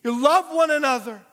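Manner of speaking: Jesus addressing his followers, loud and soaring; Jesus commanding. Acted. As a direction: lift it up high like a solemn command. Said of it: "Jesus looks at his disciples and says".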